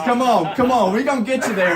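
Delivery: rally the passenger as the bus driver; imitated driver egging someone on.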